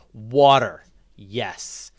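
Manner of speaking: normal talking voice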